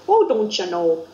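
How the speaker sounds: lightly put-on Northern Fargo accent; touch of goofy Minnesota-style Fargo accent. Quoting the movie Fargo to show Northern speech, goofy Fargo accent. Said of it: "like the movie Fargo".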